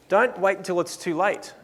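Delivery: usual speaking voice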